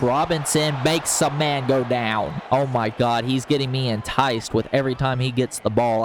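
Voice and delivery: usual speaking voice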